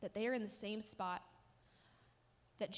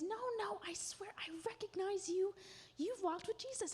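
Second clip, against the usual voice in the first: a higher, insistent servant voice pressing Peter; accusing servant; 9 semitones higher than usual. Acted. Servant insisting to Peter that he knows him, raised voice. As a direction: push the accusation, pitch up.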